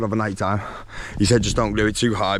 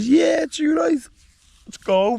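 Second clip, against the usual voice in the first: eager shout; 14 semitones higher, slower than usual. Retold or imitated. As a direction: shout it like you can't wait to go. Retold eager reply to friend.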